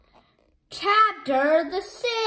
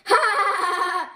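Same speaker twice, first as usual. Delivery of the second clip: loud cackling at Dogman; villain mocking the hero; mocking laugh; 5 semitones higher, 5 dB louder than usual. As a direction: laugh right in his face.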